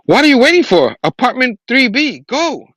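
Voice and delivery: high, forceful command; barked orders. Acted. Kareem ordering Spidey to the apartment, barked high. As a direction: bark it out to send someone off. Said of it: "bark orders at me".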